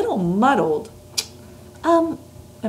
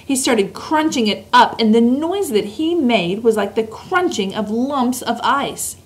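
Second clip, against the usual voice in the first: usual speaking voice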